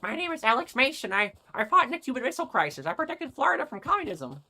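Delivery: put-on high, far-off shaky old man. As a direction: play a rambling old veteran. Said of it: "do the old man voice for nostalgia".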